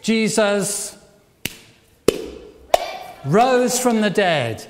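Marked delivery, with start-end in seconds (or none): none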